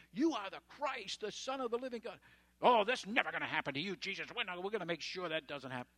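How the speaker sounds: high, loud mock Peter gushing; excited Peter. Preacher voicing Peter promising Jesus nothing will happen, hammed-up and loud. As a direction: ham up Peter's eager blurting.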